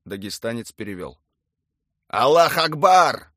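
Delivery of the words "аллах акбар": drawn-out, very loud battle cry; cry over radio. A narrator's reading. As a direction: bellow it slowly, high and loud. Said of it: "захрипела рация".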